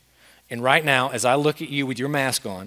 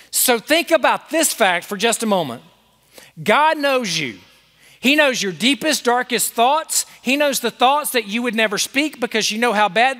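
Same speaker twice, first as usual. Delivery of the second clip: high, loud pulpit delivery of assurance; impassioned preaching; 9 semitones higher, 4 dB louder than usual. Acted. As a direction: preach it out to every listener, full voice. Impassioned preaching on God's love.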